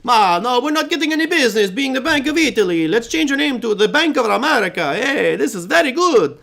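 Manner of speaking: high, hammed-up Italian accent; mock Italian banker voice, comic and exaggerated. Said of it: "I guess I have to do it in Italian voice".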